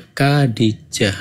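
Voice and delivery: Khadijah spelled out slowly, syllable by syllable; careful Khadijah. Shown as a demonstration. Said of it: "Bukan Khadijoh atau Khadijih".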